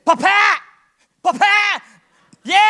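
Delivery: mock child's cry for help. Crying out to God as father.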